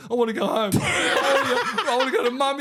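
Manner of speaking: high laughing voice; retelling his six-year-old son's whine, laughing; laughing quote. Retold or imitated. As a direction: laugh through the whining. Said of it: "turns around and goes".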